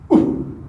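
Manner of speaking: sudden breathy puff of "ooh"; short effort "ooh". Shown as a demonstration. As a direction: cue the swing with a quick "ooh". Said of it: "Say it, say it".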